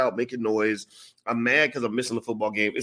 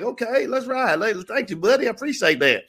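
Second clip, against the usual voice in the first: higher, folksy Kentucky twang; Kentucky lingo; 11 semitones higher than usual. Retold or imitated. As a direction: put on the local Kentucky talk to fit in. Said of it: "'cause Kentucky, you gotta, like, speak the lingo".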